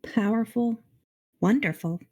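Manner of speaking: usual speaking voice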